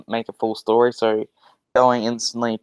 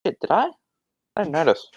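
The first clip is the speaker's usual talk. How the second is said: higher-pitched, mock-surprised self-quote; retold startled innocence; 7 semitones higher, faster than usual. Student answering classmates about the accent, startled innocence. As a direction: act out being caught unawares, pitched up. Retelling own clueless reply in class.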